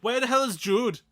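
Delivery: loud, high mock Irish shout; Irish accent. Mock Irish burglar yelling across the house for Jude. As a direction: bark it out in a hammed-up Irish accent. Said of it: "No, that was Irish".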